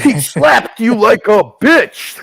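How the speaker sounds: loud, slow, shouted read-out; reading all caps. Shown as a demonstration. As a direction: read it like it's in capitals. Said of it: "all capitals".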